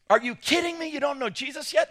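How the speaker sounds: stunned, high-pitched are-you-kidding-me; incredulous exclaim. Retold or imitated. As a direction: blurt it out like you can't believe it. Repeats shocked opening line.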